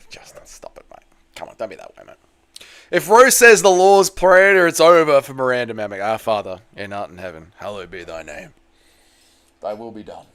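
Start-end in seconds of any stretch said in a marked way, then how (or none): 0.1-2.2 breathy whisper, no voice. Real, not acted. Teasing Justin, hushed. 6.0-10.2 mock prayer. Reciting prayer for Rose.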